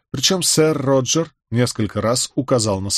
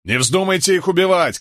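higher-pitched commanding call; shouted order; 7 semitones higher than usual. A narrator's reading. Rebel leader forbidding his men to kill, calling out. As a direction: call out a mild shouted warning. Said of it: "крикнул Кэт".

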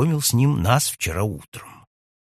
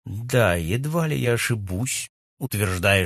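usual speaking voice

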